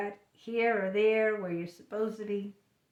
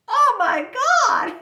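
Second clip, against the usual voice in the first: high, loud, drawn-out cry through laughter; laughing exasperation; 11 dB louder, slower than usual. Real, not acted. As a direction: wail it helplessly, breaking into laughter. Cornered even in ladies' room, laughing.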